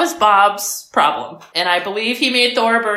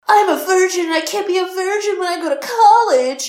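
put-on whiny teen movie character; 10 semitones higher than usual. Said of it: "every teen movie was like".

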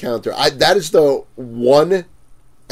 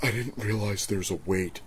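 soft, low mock customer voice; impression of the customer; 5 semitones lower, 14 dB quieter than usual. Customer answering the vendor, mimicked softly and low. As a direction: mimic him small and low. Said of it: "he just looks at me and goes".

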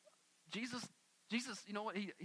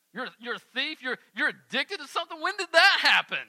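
mock shock; 3 semitones higher, 10 dB louder than usual. Mock Jesus shocked at labels.

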